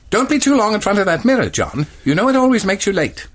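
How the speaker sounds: brisk wife nagging at the mirror; voicing Janet. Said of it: "Janet says".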